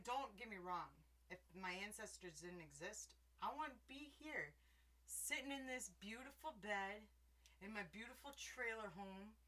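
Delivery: ordinary talk, nothing marked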